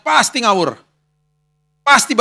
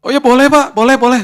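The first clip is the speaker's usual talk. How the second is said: eager agreement; 7 semitones higher, 6 dB louder than usual. Retold or imitated. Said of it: "boleh nggak".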